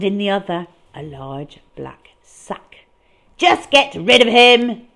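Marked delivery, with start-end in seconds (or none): 3.4-4.9 loud bellowing boss. Acted. Boss ordering the cat gone, loudly bellowing. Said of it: "Mister Fame was bellowing".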